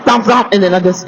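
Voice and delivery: usual speaking voice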